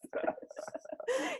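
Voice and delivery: squealing breathy laugh; high airy laugh. Real, not acted. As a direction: let a high laugh escape. Laughing at tarot story.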